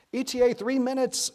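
high, loud radio shout; urgent young woman shouting into a radio. A narrator's reading. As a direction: yell the update over the wind. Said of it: "shouted the young woman".

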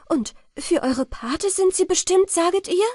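high, loud, urgent question; startled exclamation. A narrator's reading. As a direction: press the question in astonishment. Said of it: "rief sie". (aufgeregt rufend)

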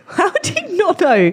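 high, laughing question; laughing disbelief. Real, not acted. Guest laughing at the host for not knowing Eden, in disbelief. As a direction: laugh it out, amazed he doesn't know.

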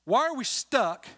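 preacher escalating the same question; stronger preaching challenge. Acted. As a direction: lean harder on the repeat. Repeated with more force.

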